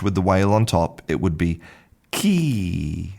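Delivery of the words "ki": "ki" as a long, held kee; changed vowel. Shown as a demonstration. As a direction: draw out the ee in "ki". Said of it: "changes the vowel sound to E".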